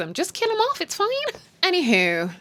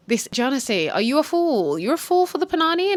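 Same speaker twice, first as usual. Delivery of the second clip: incredulous; 7 semitones higher, 3 dB louder than usual. Real, not acted. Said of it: "I was like".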